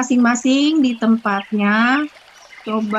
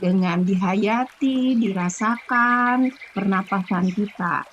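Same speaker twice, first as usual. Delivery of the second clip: ordinary talk, nothing marked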